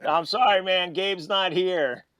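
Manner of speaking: high, loud, drawn-out put-on apology; mock apology. Dad teasing the kid at the door, mock apology.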